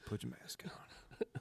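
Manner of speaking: slow breathy whisper. Acted. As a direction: echo it back in a whisper. Whispered echo of the instruction.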